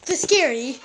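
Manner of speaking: solemn wildlife narrator voice; nature documentary. Acted. Kid narrates cats as documentary.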